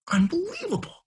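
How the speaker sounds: high, whispered mock outrage; incredulous whisper. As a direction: whisper it in acted disbelief. Acting out outrage at students.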